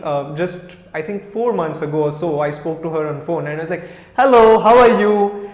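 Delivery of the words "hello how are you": higher, louder, slow beaming hello; joyful greeting. Retold or imitated. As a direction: greet an old friend with delight. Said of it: "just the way I spoke to her".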